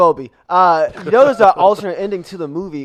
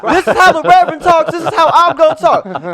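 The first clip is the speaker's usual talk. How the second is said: high, loud reverend voice; church voice; 12 semitones higher, 6 dB louder, slower than usual. Acted. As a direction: preach it like the reverend. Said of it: "This is my church voice".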